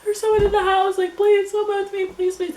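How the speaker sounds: high, loud terrified begging; panicked pleading. Retold or imitated. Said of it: "And I'm like".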